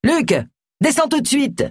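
high, sharp summoning yell; yelling upstairs. A narrator's reading. Paul at the foot of the stairs summons his son, yelling. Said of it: "Paul alla au pied de l'escalier et hurla".

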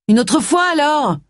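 high, hopeful call after her. A narrator's reading. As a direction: call out to her as she walks away. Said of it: "cria Simon". (crie après elle, voix haute)

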